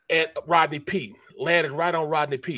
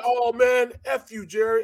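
retold cussing; 7 semitones higher than usual. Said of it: "He starts cussing again".

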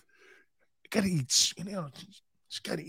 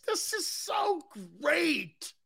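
delighted squeal; 12 semitones higher, 5 dB quieter, much slower than usual. Real, not acted. Delighted exclamation.

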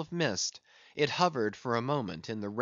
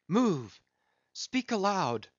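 urgent, higher and rising; rousing himself; 4 semitones higher than usual. A narrator's reading. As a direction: shake yourself awake.

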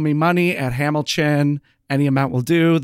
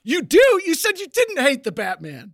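high, loud disbelieving yelp; incredulous; 10 semitones higher, 5 dB louder than usual. Real, not acted. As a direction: catch him out in disbelief. Incredulous teasing.

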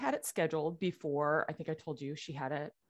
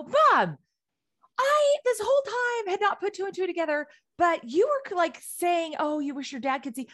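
retold frantic rush, much higher and louder; 11 semitones higher, 5 dB louder than usual. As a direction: blurt it out to mom, beside yourself. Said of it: "So I call her frantically".

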